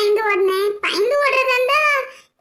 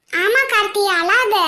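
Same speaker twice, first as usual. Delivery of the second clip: normal talking voice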